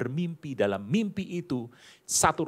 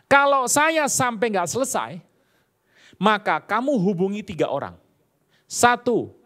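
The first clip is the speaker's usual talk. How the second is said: a hint of a stern lecturer, higher and louder; lightly voiced old supervisor laying down rules; supervisor's instruction; 6 semitones higher, 6 dB louder than usual. Preacher voices his old supervisor instructing him: mildly stern lecturer.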